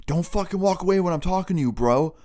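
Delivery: heated mock outburst; angry confrontation. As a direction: imitate an aggressor calling someone back. Robber confronts him as he leaves.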